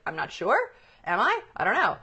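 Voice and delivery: doubtful, questioning lilt; unsure uptalk. Acted. Coach voicing what uptalk implies, unsure. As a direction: mimic someone unsure of every word. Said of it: "It's like up talk".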